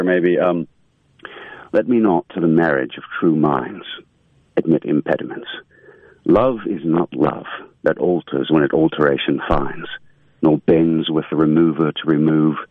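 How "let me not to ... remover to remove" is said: British accent. Shown as a demonstration. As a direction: recite the sonnet in a British accent, measured and grand. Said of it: "let's say a little Shakespeare".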